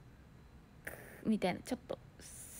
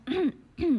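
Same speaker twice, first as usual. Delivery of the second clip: loud throat clear; 5 semitones higher, 9 dB louder than usual. Retold or imitated. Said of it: "ってやったよ".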